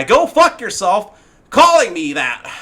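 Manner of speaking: high, loud and slow sneering mimicry; mocking imitation. Mocking the moderator's reply to a player, high, loud and slow. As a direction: ham up his nasty reply to mock it.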